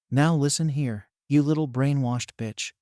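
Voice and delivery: ordinary delivery, nothing marked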